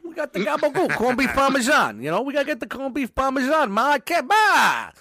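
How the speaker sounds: mock Italian-American son hollering for Ma; fake Italian accent. Mock Italian son demanding food.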